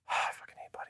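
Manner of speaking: hushed, breathy whisper. Real, not acted. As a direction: whisper it under your breath. Whispered aside about Bucky.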